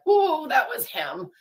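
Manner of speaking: a high, slow "whoa" of sudden recognition; stunned realization. Retold or imitated. Retelling to a podcast host the moment she realized, high and slow. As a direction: let it dawn on you who that was. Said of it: "I was like".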